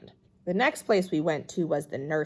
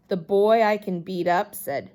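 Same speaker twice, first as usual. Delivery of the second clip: ordinary delivery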